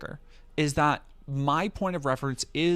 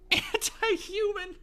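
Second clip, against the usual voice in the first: laughing; 7 dB quieter, slower than usual. Real, not acted. Laughing at chat's absurd label.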